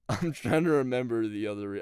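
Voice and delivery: usual speaking voice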